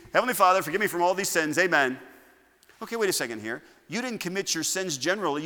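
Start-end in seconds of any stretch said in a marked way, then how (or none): 0.1-2.0 loud, hurried, careless prayer, much higher; rushed generic prayer. Pretend. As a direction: rattle off a prayer without meaning it. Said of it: "It shouldn't be".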